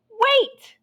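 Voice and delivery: high, loud call; hungry farmer shouts after the cookie, high and loud; shouting. Acted. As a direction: yell to stop her. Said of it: "the hungry farmer shouted".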